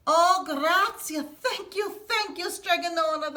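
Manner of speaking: grateful cry. Acted. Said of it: "the people cried".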